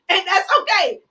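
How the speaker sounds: laughing through the words. Real, not acted. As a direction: crack up at the punchline. Laughing at one like.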